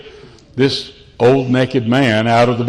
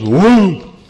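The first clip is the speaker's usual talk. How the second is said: a loud whoom of flaring fire; fire whoosh; 6 semitones higher, 4 dB louder than usual. Retold or imitated. Chef squirts fuel on the grill, loud whoom. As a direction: imitate the flame bursting up. Sushi chef's fire burst.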